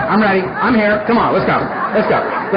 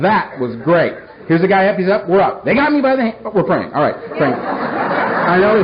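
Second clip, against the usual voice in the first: ordinary talk, nothing marked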